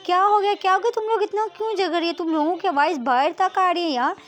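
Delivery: high, loud flurry of questions; alarmed questioning. Acted. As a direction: burst in on a quarrel, taken aback. Girls arrive asking about fight.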